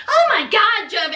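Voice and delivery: normal talking voice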